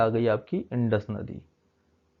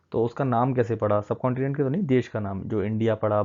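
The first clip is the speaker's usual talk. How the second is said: ordinary delivery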